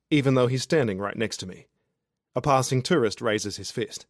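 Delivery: ordinary delivery, nothing marked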